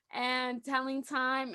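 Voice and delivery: ordinary talk, nothing marked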